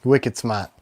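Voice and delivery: light attempt at a Massachusetts accent; terrible Massachusetts accent. Shown as a demonstration. Host trying the wife's home town, rough Massachusetts accent. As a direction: drop the r's, an amateur Massachusetts accent. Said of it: "Probably a terrible accent".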